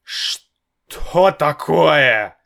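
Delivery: shaken disbelief. A narrator's reading. Father shaken.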